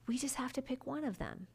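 hushed, gentle reassurance; soft whisper. Retold or imitated. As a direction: whisper it softly to calm her. Reassuring a stressed client.

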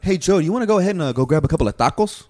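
fast, overly anglicized Spanish-English voice; mock Anglish, fast. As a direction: rattle it off in a forced English accent. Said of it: "that person was like".

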